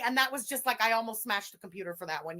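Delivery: ordinary talk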